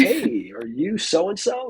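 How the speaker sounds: high, drawn-out awkward hello; tentative greeting. Retold or imitated. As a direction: act out checking you've got the right person. Awkward greeting at confused host's door.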